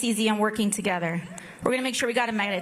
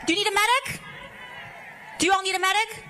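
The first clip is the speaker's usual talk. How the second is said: calling for a medic; 7 semitones higher, much faster than usual. Real, not acted. Crowd member collapsed at rally.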